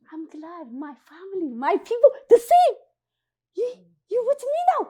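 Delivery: high, loud, fast happy greeting; excited relief. Retold or imitated. Refugee greeting her own people with excited relief. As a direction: gush with relief. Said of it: "like I say".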